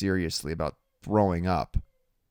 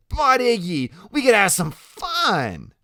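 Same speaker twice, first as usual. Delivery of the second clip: very high, drawn-out plea; Loretta, coaxing; 13 semitones higher, 6 dB louder, slower than usual. Acted. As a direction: wheedle loudly in Loretta's voice.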